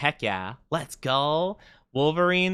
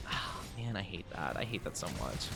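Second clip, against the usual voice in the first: low, much softer groan of defeat; defeated mutter; 7 semitones lower, 16 dB quieter than usual. Real, not acted. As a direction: grumble it under your breath. Groans about forgetting audio.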